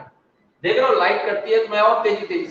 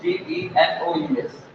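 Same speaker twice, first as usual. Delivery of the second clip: slow spelling; 7 semitones higher, slower than usual. Shown as a demonstration. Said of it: "heterogeneous का मतलब".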